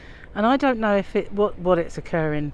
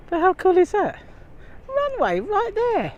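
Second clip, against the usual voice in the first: high, delighted exclamation; thrilled by the nearby runway; excited; 11 semitones higher than usual. Real, not acted.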